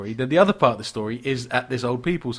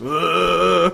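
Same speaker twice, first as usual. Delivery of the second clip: loud, raised imitation zombie moan; zombie groan; 4 semitones higher, 6 dB louder than usual. Zombie coming up behind the pensioner, comic drawn-out groan.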